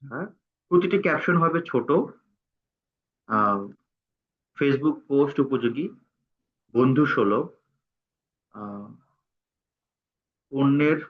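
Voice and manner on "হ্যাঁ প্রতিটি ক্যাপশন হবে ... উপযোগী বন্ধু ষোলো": usual speaking voice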